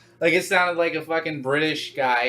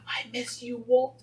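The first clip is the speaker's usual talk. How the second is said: high, soft mock-sentimental plea; mock wistful; 8 semitones higher, 5 dB quieter, slower than usual. Fan pining to Walt Disney's picture, mock wistful. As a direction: pine softly in a high little voice. Voicing someone gazing at a picture.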